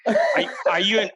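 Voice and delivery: ordinary talk, nothing marked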